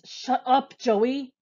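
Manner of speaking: screaming. Acted. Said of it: "Frosty screams".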